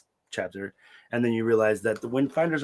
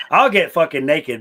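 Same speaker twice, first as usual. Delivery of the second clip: loud, high emphatic blurt; 5 semitones higher, 8 dB louder than usual. Real, not acted. Emphatic joke.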